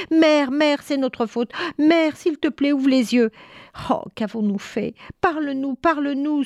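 high-pitched, distressed sons begging; acted anguish of grieving children; tearful pleading. A narrator's reading. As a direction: beg desperately, as if in tears. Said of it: "se mirent à pleurer".

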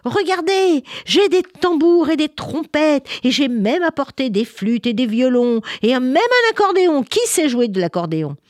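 high, loud proud voice; excited little girl. Acted. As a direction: show off the instruments eagerly. Girl shows instruments excitedly.

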